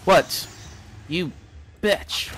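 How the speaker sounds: loud, high startled shout; startled cry at an enemy; shocked yell. Real, not acted. Surprised by enemy.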